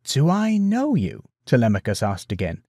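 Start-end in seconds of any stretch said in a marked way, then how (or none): 0.0-1.2 slow, high, drawn-out suspicious question; wary, lingering query. A narrator's reading. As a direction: stretch it out warily, pitch climbing. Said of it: "there was more suspicion to the tone".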